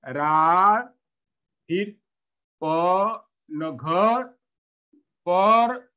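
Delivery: broken syllable-by-syllable misreading; child stumbling over syllables. Shown as a demonstration. Teacher imitating a child misreading, syllable by syllable. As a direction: stumble through the syllables like a struggling reader. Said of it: "गलती करे कि रानी".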